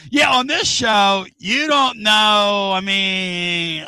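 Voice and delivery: high, loud, stretched-out exclamation; exasperated outcry. Real, not acted. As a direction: cry out, dragging the words long. Host exclaims over show's troubles.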